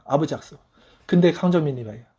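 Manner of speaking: usual speaking voice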